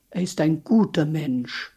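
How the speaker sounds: normal talking voice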